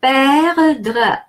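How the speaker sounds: "Perdre" with open E as in egg; French pronunciation. Shown as a demonstration. Teacher repeating the word for learners, "Perdre" with an open first E. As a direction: sound out "Perdre" carefully in French. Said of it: "E followed by two consonants".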